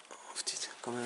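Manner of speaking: breathless whispered swear; whispered curse. Real, not acted. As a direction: curse under your breath. Exploring a dark building.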